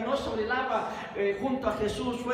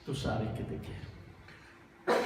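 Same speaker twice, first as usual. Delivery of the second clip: slow, broken whisper, much lower and softer; tearful whisper; 9 semitones lower, 6 dB quieter, much slower than usual. Acted. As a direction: whisper it as if crying. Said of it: "yo creo que llorando". (susurro lento y lloroso)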